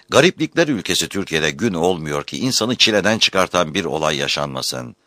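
usual speaking voice

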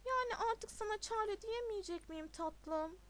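put-on coy girlfriend. A narrator's reading. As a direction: play a girlfriend wheedling her darling. Girlfriend cooing at boyfriend in story.

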